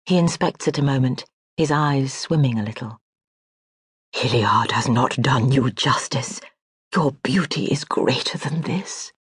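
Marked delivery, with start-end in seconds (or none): 4.1-9.1 breathy whispered admiration; hushed admiring. Acted. Admirer whispering praise to the lady he serves. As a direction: whisper it like a secret compliment. Admirer speaks softly of portrait.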